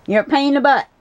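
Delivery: high playful teasing; high-voiced pet talk; affectionate scolding. Real, not acted.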